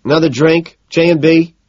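"J and B" loud, high and questioning; phrased as a question. A narrator's reading. Man ordering from a passing waiter, loudly questioning. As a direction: call the order "Another drink" as a request. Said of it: "I phrased it as a question".

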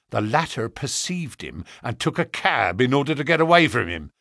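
ordinary delivery

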